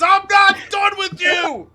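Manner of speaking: high, loud, slow angry yell; wrestler shout. Retold or imitated. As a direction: scream it like a heel wrestler. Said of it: "Luke Gallows comes out to the ring and says".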